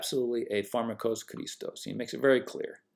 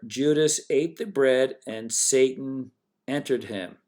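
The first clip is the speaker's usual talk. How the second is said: usual speaking voice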